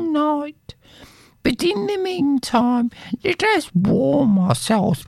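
high, complaining poor wife; wife's voice. Acted. Wife grumbling to her husband about poverty, high voice. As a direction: sigh over being poor.

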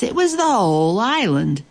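ordinary delivery, nothing marked

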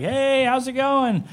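high, drawn-out singsong hello; hammed-up happy homecoming; cheery mock greeting. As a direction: sing out the greeting, high and slow. Retold cheerful greeting coming home.

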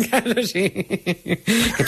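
amused, laughing through the words; laughing admission. Real, not acted. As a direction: admit it while laughing at yourself. Caught out, laughs at herself.

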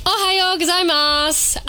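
Ohio gozaimasu said slowly in a broad Australian twang; Australian-accented Japanese. Shown as a demonstration. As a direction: drawl Ohio gozaimasu with Aussie vowels. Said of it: "Nice little Australian twang there on her Japanese".

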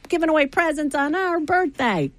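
high, fast birthday cheer; excited. Real, not acted. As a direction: celebrate the station's birthday. Celebrating station birthday.

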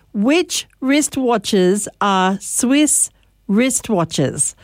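slow, deliberate tongue twister; slow and careful. Shown as a demonstration. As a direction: take each word slowly and carefully. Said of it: "slow and comfortable".

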